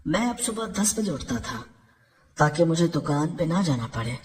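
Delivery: ordinary delivery, nothing marked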